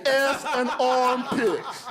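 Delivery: slow, stretched mocking chant with a laugh in it; drawn-out taunt. Teen kid taunting the security guard, slowly and laughing. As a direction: drag each word out like a kid teasing.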